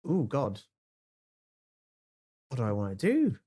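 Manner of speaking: usual speaking voice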